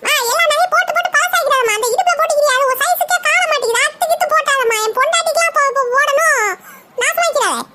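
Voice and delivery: put-on high, giggling boy's voice, sly; teasing grandson. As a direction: tease grandma with a laugh like a naughty kid. (சிரிப்புடன் கிண்டல் செய்யும் பேரன் குரல்)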